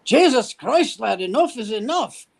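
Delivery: hammed-up Scottish star blowing up at a photographer; imitating Connery's yell. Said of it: "is screaming at me".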